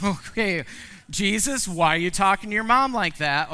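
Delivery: shocked disapproval. Retold or imitated. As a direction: scold him, half amused. Shocked reaction to rude son.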